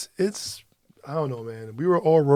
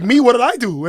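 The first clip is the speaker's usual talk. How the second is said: higher, louder, wide-eyed innocence; innocent surprise; 9 semitones higher, 8 dB louder than usual. Retold or imitated. Oblivious wife asking what she did, innocent surprise. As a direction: play totally clueless. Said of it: "She was like".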